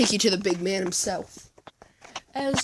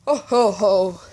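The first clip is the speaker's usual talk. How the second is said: Santa laugh; 10 dB louder than usual. Acted. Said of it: "Santa's just like".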